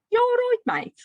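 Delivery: high, loud Brummie greeting; Brummie accent. Shown as a demonstration. Teacher models a little phrase for listeners, in high, loud Brummie. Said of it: "work on your Brummie".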